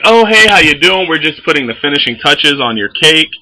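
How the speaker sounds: high, loud, bubbly shop lady; chipper baker voice. Retold or imitated. The baker greets the customer, chipper and high. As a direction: play the overly cheerful baker greeting her. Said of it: "she's like".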